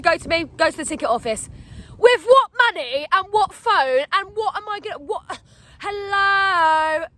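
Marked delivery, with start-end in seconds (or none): 2.0-7.1 high, loud incredulous demand; indignant, hammed-up protest; re-enacted exasperation. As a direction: throw questions back in disbelief. Acts out protest at police.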